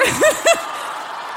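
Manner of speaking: very high burst of laughter. Real, not acted. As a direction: squeal with laughter. Laughing at the song.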